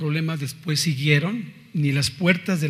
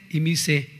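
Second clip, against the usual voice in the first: ordinary delivery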